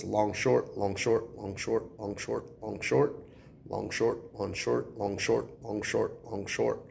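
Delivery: "long, short" chanted in even, regular pairs; counting the beat. Shown as a demonstration. Teacher counting iambic pentameter for students, "long, short" in steady rhythm. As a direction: tap out "long, short" like a metronome. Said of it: "Let's check out the beat here".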